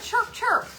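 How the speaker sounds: high, piping chick chirps. Shown as a demonstration. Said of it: "Make the noise".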